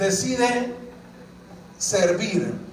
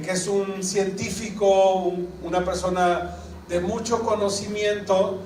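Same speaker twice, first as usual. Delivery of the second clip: ordinary talk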